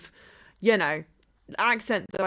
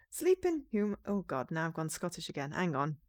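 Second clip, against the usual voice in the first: ordinary talk, nothing marked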